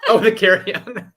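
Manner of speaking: laughs through the words; amused laugh-speak. Real, not acted. Laughing remembering the carry-on.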